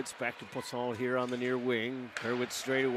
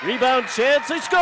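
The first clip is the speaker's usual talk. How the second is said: much higher, loud shouted goal call; excited goal call; 12 semitones higher, 13 dB louder than usual. Real, not acted. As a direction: explode on the goal.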